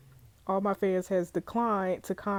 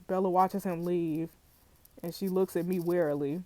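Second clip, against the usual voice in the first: ordinary talk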